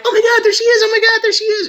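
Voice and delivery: high, loud gushing fan squeal; mock fan gasping at a celebrity; mock starstruck fan. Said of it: "people are like".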